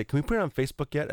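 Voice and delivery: pestering question. Retold or imitated. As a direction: imitate someone asking yet again, quickly. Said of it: "Nick's been bothering me for weeks".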